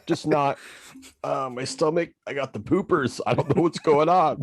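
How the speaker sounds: high, pitiful whining complaint; mock sickly husband. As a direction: play the poisoned husband feeling sick. Pretending poisoned husband.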